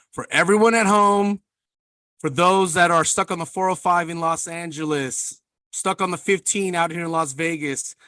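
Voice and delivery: podcast host bigging up the guest's entrance; announcer intro. Acted. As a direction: build up the guest like a ring announcer.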